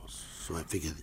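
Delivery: normal talking voice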